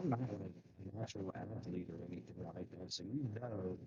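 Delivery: muffled, as if mic is covered; speaking through a covered microphone. Real, not acted. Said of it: "your audio's a little muffled".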